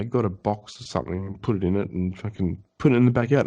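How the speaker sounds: ordinary talk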